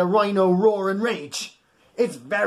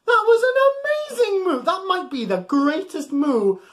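delighted praise; 12 semitones higher than usual. Real, not acted. Praising children's big moo.

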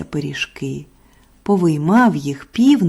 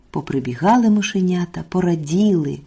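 usual speaking voice

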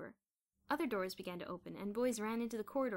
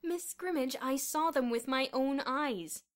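loud, raised, emphatic protest; angry insistence; 5 semitones higher, 8 dB louder, slower than usual. A narrator's reading. Headmaster arguing with Miss Scrimmage by phone, angry. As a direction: insist on being believed.

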